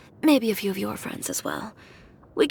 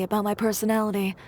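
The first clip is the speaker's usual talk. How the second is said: ordinary delivery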